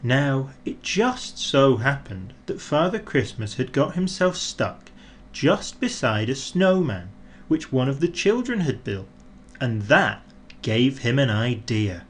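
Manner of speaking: ordinary delivery, nothing marked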